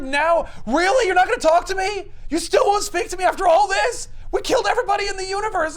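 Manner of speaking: high, loud hammed-up outrage; indignant protest. Young Thanos pleading with silent Death, high indignant mock shouting. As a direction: shout it like a spurned, whiny suitor.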